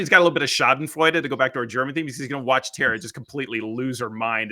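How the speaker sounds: ordinary talk, nothing marked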